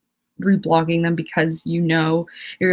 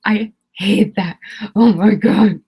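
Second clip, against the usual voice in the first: exasperated outburst; 6 dB louder, slower than usual. Real, not acted. Exasperated rant about themes.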